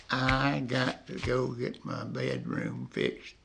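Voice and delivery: slow, lazy imitation of a Mississippi drawl; slow Mississippi talk. Old man mimics slow-talking Lisa, lightly drawn-out slow speech. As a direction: stretch each word out like a slow-talking Mississippi girl. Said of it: "they just, they just talk slow".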